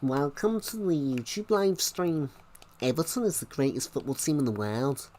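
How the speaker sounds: Scouse impression showing off for the stream; Scouse accent. Shown as a demonstration. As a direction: put on a broad Liverpool Scouse accent. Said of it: "I can do Scouse".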